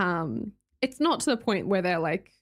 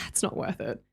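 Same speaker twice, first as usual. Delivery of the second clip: sighed, breathy whisper, low and much softer; weary whisper; 11 semitones lower, 7 dB quieter than usual. Retold or imitated. Streamer telling chat why she skips streams, in a tired whisper. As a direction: let it fall out in a tired whisper. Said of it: "I'm like".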